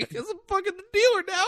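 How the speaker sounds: very high mock-helpless voice; flustered squeak. Imitating the cop forced to deal cards, flustered high squeak.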